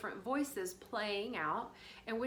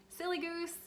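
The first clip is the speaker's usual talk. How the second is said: higher, playful chiding; gently tease, pitched up high; bigger inner voice; 8 semitones higher than usual. Acted. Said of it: "bigger voice came in and was like".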